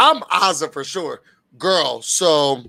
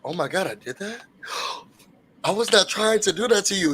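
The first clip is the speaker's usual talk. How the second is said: much softer gasping apology; soft mock shock; 6 dB quieter than usual. Shown as a demonstration. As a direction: pull it in, act surprised. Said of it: "You say".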